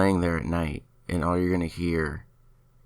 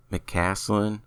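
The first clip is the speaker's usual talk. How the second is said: usual speaking voice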